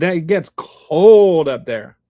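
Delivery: long, loud, drawn-out "cold". Real, not acted. Streamer to chat about Wisconsin winters, dragging out "cold" loudly. Reacting to Green Bay cold.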